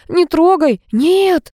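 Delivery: high, frantic protest; acted hysterical outcry; panicked cry. A narrator's reading. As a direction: beg them high and urgently to stop. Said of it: "закричала Галя".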